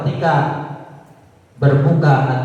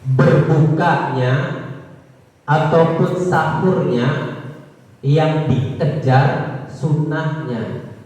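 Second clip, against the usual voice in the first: slow emphasis; much slower than usual. Acted. Preacher slows to stress point.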